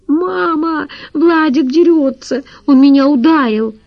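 put-on high, whiny child's voice; small girl running to mum about her brother; little girl tattling. Little girl complains to mother.

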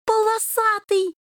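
high, slow joyful exclamation; joyful cry. A narrator's reading. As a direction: cry the name out in delight. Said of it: "радостно вскрикнул Рыжий".

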